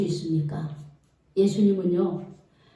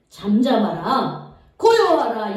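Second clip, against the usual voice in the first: slow, louder, deliberate command; 3 semitones lower, 4 dB louder, slower than usual. Acted. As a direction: order the storm to be still, word by word. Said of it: "이렇게 명령을 하니까요". (바람에게 천천히 힘주어 명령하듯)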